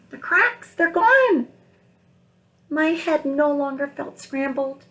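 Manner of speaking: a high, bright lift on the cracks being gone; joyful story narration. Acted. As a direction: read the egg's relief aloud to kids. Teacher reading picture book aloud.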